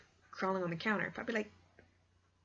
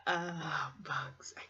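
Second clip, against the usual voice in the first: hushed shudder of a whisper; soft whispered dread; 2 semitones lower, 3 dB quieter, much slower than usual. Real, not acted. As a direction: breathe it out, creeped out. Shudder at thought of bugs.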